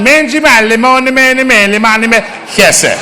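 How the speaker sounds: high-pitched, singsong Italian gibberish; mock Italian accent. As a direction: babble like a caricature Italian. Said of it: "You can have Italy talking".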